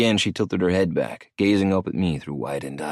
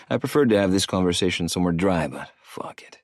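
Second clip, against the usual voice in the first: usual speaking voice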